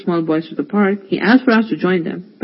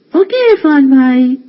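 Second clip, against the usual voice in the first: put-on high, louder children's voices; little boys in chorus; 7 semitones higher, 6 dB louder than usual. The two boys answering Irfan bhai together, childlike. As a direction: chirp it like small boys. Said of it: "said the boys together".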